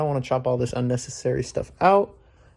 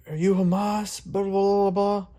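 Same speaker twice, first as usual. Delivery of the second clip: mock heckler; 7 semitones higher than usual. Heckler locks onto a bystander, high mocking imitation. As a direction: mimic an accusing ranter dissolving into babble. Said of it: "he, like, locks onto this guy".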